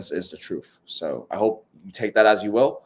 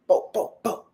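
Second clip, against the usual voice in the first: hype noise; 12 semitones higher than usual. Pretend. Hype sound effect.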